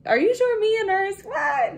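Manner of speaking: can't believe it's really you; incredulous. Pretend. Mock disbelief at being nurse.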